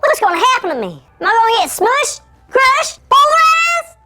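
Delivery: high, small voice in mounting panic; chipmunk voice. Acted. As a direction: squeak out rising alarm like a tiny chipmunk. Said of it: "Why do I sound like a chipmunk?".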